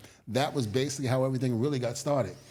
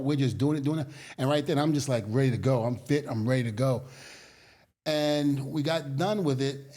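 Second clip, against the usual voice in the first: ordinary talk, nothing marked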